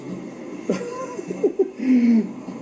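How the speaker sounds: long laugh shooting up high; high falsetto laugh. Real, not acted. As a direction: let a squeaky laugh ring out. Laugh alone.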